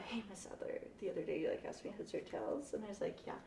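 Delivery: quiet. Retold or imitated. Quoting the girl softly.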